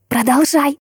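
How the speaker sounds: high-pitched eager urging; excited exclamation. A narrator's reading. Friend wanting the rest of the story, exclaiming. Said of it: "воскликнула Биби".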